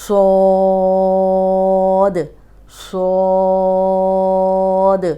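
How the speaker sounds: صاد held very long and loud, heavy; long heavy saad. Shown as a demonstration. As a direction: sing out صاد full and long. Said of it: "صاد موٹا حرف ہے". (صاد کو موٹا اور لمبا کھینچ کر پڑھنا)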